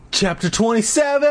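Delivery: chapter announcer. Acted. Reader to listeners announcing a new chapter, high announcer call. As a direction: announce the heading high and ring out the last word.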